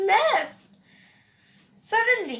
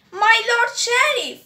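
higher and louder call across the hall; 7 semitones higher, 4 dB louder than usual. A narrator's reading. Messenger at the door calls loudly to the sheriff. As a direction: call out to get the lord's attention. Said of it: "a harsh voice came loudly".

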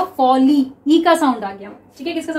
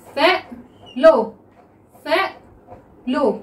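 Fellow stretched slowly, twice; slow drawn-out reading; much slower than usual. Shown as a demonstration. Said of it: "next देखिए fellow". (Fellow को धीरे पढ़ना)

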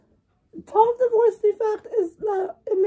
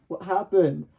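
deep cartoon voice. Deep character asks.

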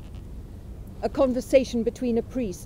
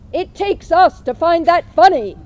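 much louder and higher, repeating for someone who didn't hear; said loudly; 6 semitones higher, 11 dB louder than usual. A narrator's reading. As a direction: say it again loud so he catches it. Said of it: "I said loudly".

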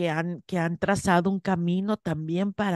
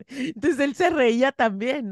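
ordinary delivery

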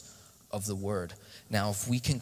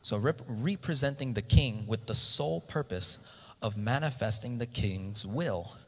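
ordinary talk

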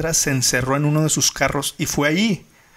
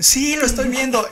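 panicked caller; 9 semitones higher, 5 dB louder than usual. Retold or imitated. Retold frantic 911 call.

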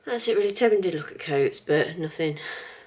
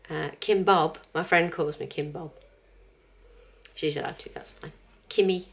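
ordinary talk, nothing marked

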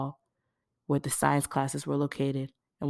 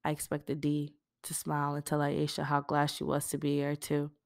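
ordinary delivery, nothing marked

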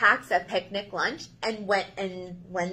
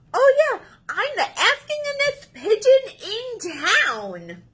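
loud, whiny pigeon pleading its case; a needy bird bursting with complaints; excitable pushy pigeon; 10 semitones higher, 4 dB louder than usual. Acted. Pigeon pestering the Duckling about the cookie, whiny and loud.

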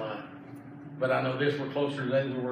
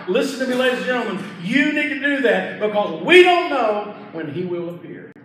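urgent preaching, building louder and higher; full-force preaching; 6 semitones higher, 6 dB louder than usual. Real, not acted. Preacher urging listeners to surrender to Christ, full-force preaching. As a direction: preach it hard, driving toward a forceful peak.

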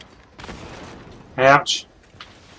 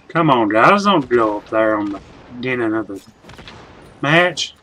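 ordinary delivery

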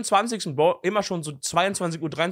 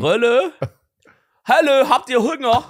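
hoch, laut und langsam, ostdeutscher Dialekt; 7 semitones higher, 8 dB louder, slower than usual. Retold or imitated. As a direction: ostdeutschen Dialekt übertrieben nachmachen. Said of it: "wie war dieser Dialekt? Von so Ostdeutsch?". (spöttisch übertriebener ostdeutscher Dialekt)

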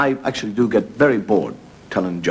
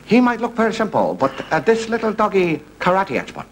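higher, sing-song mock Japanese accent; 9 semitones higher than usual. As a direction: play the Japanese dog breeder with a hammed-up accent. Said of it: "there's a Japanese fellow".

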